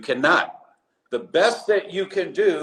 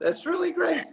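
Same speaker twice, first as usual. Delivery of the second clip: much higher, choked-up thank-you; teary thanks; 14 semitones higher than usual. Retold or imitated. Doctor tearfully thanking the patient who danced, high choked voice. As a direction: voice cracks up high, close to tears. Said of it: "I had tears in my eyes".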